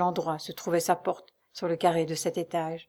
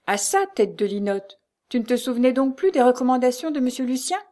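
higher, louder rebuke; scolding exclamation; 6 semitones higher, 5 dB louder than usual. A narrator's reading. Concierge berating his wife once they are alone, scolding. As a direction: scold with indignant force, rising at the end. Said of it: "s'écria le concierge".

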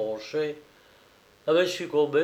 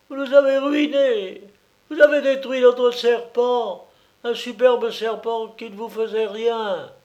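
higher and louder, ranting at him; furious accusation; 10 semitones higher, 5 dB louder than usual. A narrator's reading. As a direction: keep roaring the blame. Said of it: "rugit la grosse colombine".

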